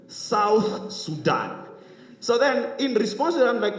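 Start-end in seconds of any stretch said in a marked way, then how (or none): 0.1-1.6 SOUTH SUDAN stretched out very slowly; slow, deliberate stress on South Sudan; emphatic correction. Retold or imitated. Retold emphatic correction of origin.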